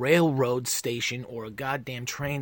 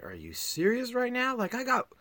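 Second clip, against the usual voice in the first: incredulous; 10 semitones higher than usual. Retold or imitated. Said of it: "I was like".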